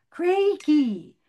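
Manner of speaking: high, loud call across the pond. A narrator's reading. Miriam calling Crakey in the mill pond. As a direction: call her name far over the water.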